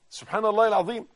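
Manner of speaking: ordinary delivery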